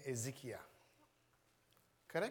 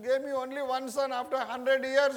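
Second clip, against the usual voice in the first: raised, loud pleading cry to God; pleading prayer; 8 semitones higher, 7 dB louder than usual. Acted. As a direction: beg God loudly for a son. Preacher voices Hannah pleading God.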